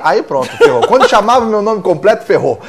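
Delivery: laughing while telling it; amused, laughing as she recalls. Real, not acted. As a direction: chuckle through the words.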